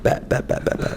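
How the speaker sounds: rapid hushed bet bet bet, eager; whispered bet. Retold or imitated. Agreeing to his mentor's advice, a quick eager whisper. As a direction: fire them off in an eager whisper.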